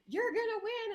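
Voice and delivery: high-pitched mock scream of insistence; imitated screaming. Friend insisting to the candidate outside, imitated screaming. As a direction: play the friend squealing it, voice way up. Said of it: "screaming at each other 'cause she's like".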